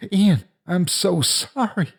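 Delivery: high, slow, anguished apology; distraught apology. A narrator's reading. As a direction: cry out an apology through a door. Banging on friend's locked door, apologizing.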